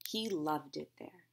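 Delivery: usual speaking voice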